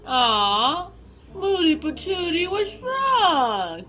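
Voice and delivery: put-on high-pitched, babying sympathy; playful cooing concern. Host comforting Jay after a hellish day, high cooing concern. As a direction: coo over someone in a mock-pouty fuss.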